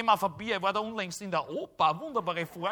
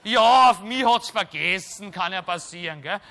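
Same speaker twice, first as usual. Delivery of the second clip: usual speaking voice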